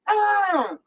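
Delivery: usual speaking voice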